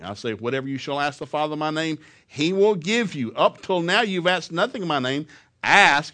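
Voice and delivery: loud, rising preaching; preached scripture. Acted. Preacher proclaiming the promise, loud. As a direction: build the verse up loud.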